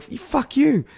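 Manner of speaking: high-pitched yelled retort; retold defiant shout. Runner answers the driver, defiant high shout. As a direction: throw the insult back in a high voice.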